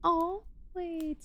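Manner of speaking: high, soft sudden catch; just spotted something, squeak it; surprised. Real, not acted. Noticing something.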